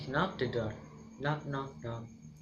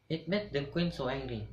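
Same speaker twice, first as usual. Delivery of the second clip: ordinary delivery, nothing marked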